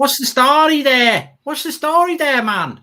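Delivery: high, loud mock Dublin greeting; Dublin accent. Shown as a demonstration. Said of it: "the Dublin one down to a bloody T".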